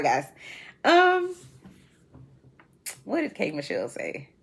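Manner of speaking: ordinary talk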